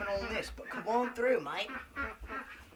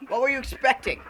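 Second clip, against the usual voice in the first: loud, high mock outrage; mock indignation; 7 semitones higher, 9 dB louder than usual. Host scolding viewers over nothing there, mock indignant. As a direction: raise your voice at the gullible viewers.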